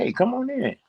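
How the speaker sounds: high-pitched, welcoming mock call; calling him in. Son retells inviting his stepfather in, high welcoming call. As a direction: re-enact a friendly call toward the door.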